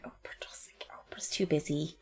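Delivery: hushed whisper; whispered aside. Retold or imitated. Speaker's retort about Oprah, conspiratorial whisper. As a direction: whisper it like gossip. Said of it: "I'm like".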